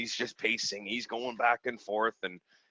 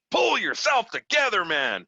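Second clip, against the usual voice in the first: high, loud snap to pull himself together; tough-love command; 7 semitones higher, 4 dB louder than usual. Retold or imitated. Telling a panicking friend to pull himself together, high loud tough-love bark. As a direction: jolt him out of it. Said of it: "and then it was like".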